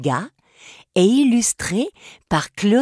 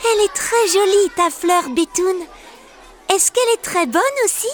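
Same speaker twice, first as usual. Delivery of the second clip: put-on high, fast cheerful girl voice; girl charmed by her flower plate; Céleste delighted; 12 semitones higher, faster than usual. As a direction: brighten up, smiling. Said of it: "et dit".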